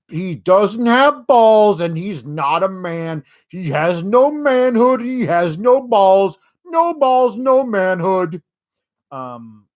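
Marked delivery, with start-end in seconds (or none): 0.1-8.4 mock macho rant. Lashley taunting Rusev, mock macho chant. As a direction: pound each no like a macho wrestler. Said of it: "was basically the thrust".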